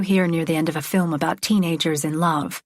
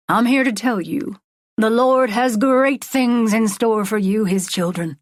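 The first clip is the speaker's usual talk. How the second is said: raised, stretched-out preacher voice; revival preaching; 3 semitones higher than usual. Acted. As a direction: preach it to the whole stadium. Preacher addresses stadium.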